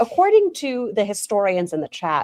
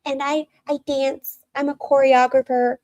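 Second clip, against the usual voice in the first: high, airy mock-introduction voice, slowed down; imitating Amberlynn Reed; 7 semitones higher than usual. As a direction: parody her sweet vlog greeting. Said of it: "she's like".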